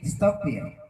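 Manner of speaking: "stop here" run together, British accent; British style. Shown as a demonstration. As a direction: blend "stop here" into one word the British way. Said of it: "pronunciation-nya digabung". ("stop here" digabung, logat British)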